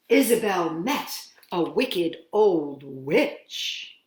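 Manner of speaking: ordinary delivery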